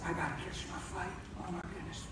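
hushed, breaking off into a whisper; near-tearful whisper. Retold or imitated. Telling the booth man he must catch his flight, tearful whisper. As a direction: let the voice fall to a tearful whisper. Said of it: "I started to cry".

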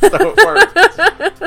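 high and loud through a laugh. Real, not acted. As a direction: giggle it out. Laughing at the joke.

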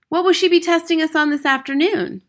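put-on high, loud little girl voice; high child's question in class; small girl. A narrator's reading. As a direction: pipe up as a small schoolgirl. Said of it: "a small girl asked".

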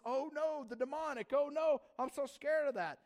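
loud, high exaggerated dread; hammed-up scaredy-cat panic; mock fear. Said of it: "this kind of fear is like this".

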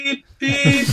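high, thin electronic beep-beep; reversing truck beeps. Pretend. Joking on the podcast that they're backing up in time, piping high beeps. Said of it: "That's the sound of us backing up".

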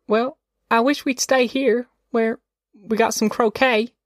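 put-on high-pitched child voice, wishing aloud; little girl reluctant to leave, pitched high; small high voice of a child; wistful little girl. A narrator's reading. Narrator voices young Ruthie.

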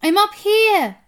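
high, loud call from afar; shouting back. A narrator's reading. Boy up a tree answering his grandmother, shouting. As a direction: holler it high to someone far below. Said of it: "I shouted back".

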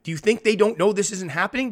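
ordinary delivery

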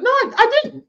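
high, squeaky and a bit loud; high mock protest; squeaky mock denial. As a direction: imitate her denying it in a squeak. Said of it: "And she said".

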